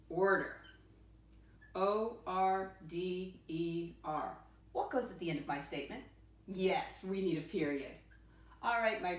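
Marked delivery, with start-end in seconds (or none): 1.7-4.4 slow letter by letter spelling; spelled out slowly. Shown as a demonstration. Teacher spelling a word for her class, slowly. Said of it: "How do I spell that little word in?".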